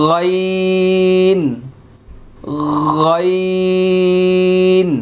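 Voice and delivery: غين held long and thick from the throat; drawn-out tajweed recitation of غين; heavy Arabic letter. Shown as a demonstration. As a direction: stretch غين out slowly, full and heavy. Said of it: "এই যে মোটা হরফ".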